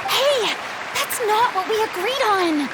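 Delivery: startled protest. Acted. Furina objecting to being named on stage, flustered. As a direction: blurt it out, caught off guard.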